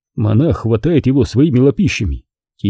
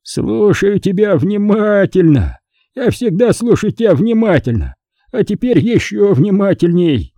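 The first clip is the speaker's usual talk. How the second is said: put-on town drunk; 8 semitones higher than usual. A narrator's reading. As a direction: stretch the words like a happy drunk. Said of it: "Он пьяно улыбается и тянет".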